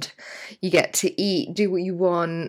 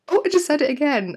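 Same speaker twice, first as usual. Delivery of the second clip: high, quick self-catching exclamation; mock dismay; 9 semitones higher, 4 dB louder, faster than usual. Listener hearing their own voice played back, mock dismay. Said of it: "It's so mad when you listen".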